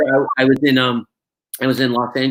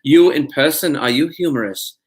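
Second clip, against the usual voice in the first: put-on stiff, earnest German-accented interviewer; German reporter accent. German reporter questions actor at convention, German accent. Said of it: "this reporter asked me".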